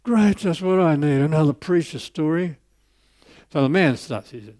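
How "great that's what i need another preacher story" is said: mock groan, a bit higher, sarcastic; groaning sarcasm. Professor groaning to himself about the old man's story, mock groan. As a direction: grumble it like a trapped listener. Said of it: "the professor's groaning".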